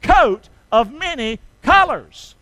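slow, loud, separated words; emphatic beats for joint recital; say-along. Shown as a demonstration. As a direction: lead the crowd in the answer. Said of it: "say it now".

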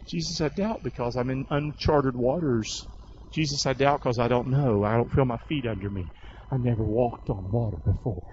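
a hint of a hesitant believer praying; quiet confessing prayer. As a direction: pour out your doubt gently. Voicing doubting believer praying.

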